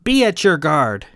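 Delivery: loud clipped command; barked order. Acted. Captain barks an order to the bridge, loud. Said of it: "Davidge barked".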